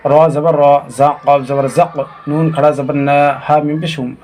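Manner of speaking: ordinary talk, nothing marked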